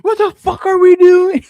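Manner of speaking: put-on loud, high kid's yell; a small kid parroting the grown-up; five-year-old copying. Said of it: "my five-year-old jumps up and goes".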